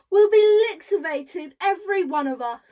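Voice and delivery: old woman's hammed-up dismay; wailing cry. A narrator's reading.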